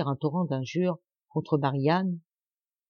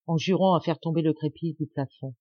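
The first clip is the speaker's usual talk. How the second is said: ordinary delivery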